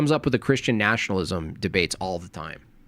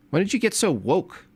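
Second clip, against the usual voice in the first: higher teasing interrogation; mock scolding. Teasing a friend who went woke, mock scolding. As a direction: needle him like a suspicious parent.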